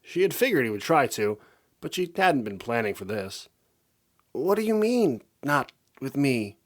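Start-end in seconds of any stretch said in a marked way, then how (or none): 4.3-6.6 high, then slow and hurt; wounded disbelief; crushed little plea; heartbroken. A narrator's reading. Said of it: "she asked in a heartbroken tone".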